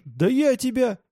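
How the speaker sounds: high, outraged, broken-off threat; indignant, pitch shooting up. A narrator's reading. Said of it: "возмутился Паша".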